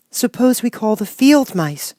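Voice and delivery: put-on high, slow young girl with an idea; girl suggesting. A narrator's reading. Girl suggesting a plan, high young voice. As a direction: offer it brightly as a little girl. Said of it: "she suggested".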